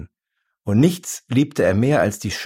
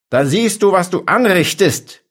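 high, loud yell of fury; enraged shout; 10 semitones higher, 5 dB louder than usual. A narrator's reading. Shopkeeper after the vitrine breaks, enraged shout. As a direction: yell it, beside yourself with rage. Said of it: "schrie der Mann, schnaubend vor Wut".

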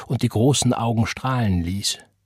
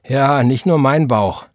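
slow, louder, higher retort; indignant; 4 semitones higher, 6 dB louder than usual. A narrator's reading. Roy arguing with his mother, indignant. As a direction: throw it back in indignation. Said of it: "empörte sich Roy".

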